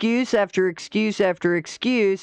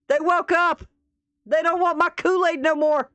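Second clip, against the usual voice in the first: high, whiny mocking voice of a panicking fraud; mock panicked charlatan; 7 semitones higher than usual. Mocking a podcast guest who panics at being exposed, high and whiny. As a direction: whine it like a fraud caught out.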